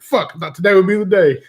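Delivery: hammed-up wife's complaint; imitating disappointed wife. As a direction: play her fake disappointment. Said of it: "She's like".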